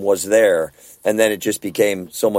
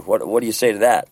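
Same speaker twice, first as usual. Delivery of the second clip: ordinary talk, nothing marked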